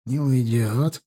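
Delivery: ordinary delivery, nothing marked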